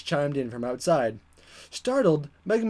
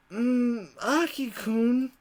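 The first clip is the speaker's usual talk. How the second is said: sleepy mumble; 5 semitones higher, 4 dB quieter, much slower than usual. Acted. Sleepy moan voicing Ryo.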